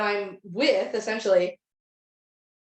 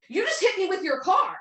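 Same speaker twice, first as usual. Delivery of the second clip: shocked yell; 9 semitones higher than usual. Retold or imitated. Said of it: "a woman say".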